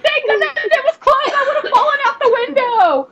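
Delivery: high-pitched squeal through laughter; laughing relief. Retold or imitated. Streamer retelling a near fall to friends, high laughing relief. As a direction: squeal it, half laughing at yourself.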